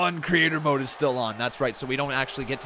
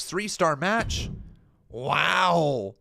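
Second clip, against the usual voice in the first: normal talking voice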